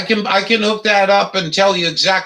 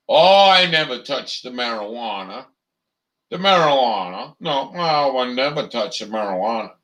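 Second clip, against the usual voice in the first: mock prissy denial; 6 semitones lower than usual. Mocking a politician who denies smoking a joint, prissy put-on voice. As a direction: lower put-on voice of a stuffy official.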